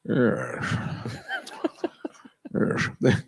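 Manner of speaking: drawn-out Scottish yes; slushy S on a single word; Scottish star impression; Sean Connery. Shown as a demonstration. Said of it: "you don't even have to say words".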